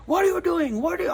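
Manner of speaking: high-pitched mock yell; retold screaming. Store owner recounting neighbor screaming at him, retold scream. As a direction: imitate an angry scream, pitched well up. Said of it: "screaming at me".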